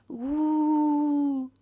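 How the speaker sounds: high, loud spooky ghost wail; a long haunting ooh; ghostly ooh. Pretend. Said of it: "This is now Ghost A S M R".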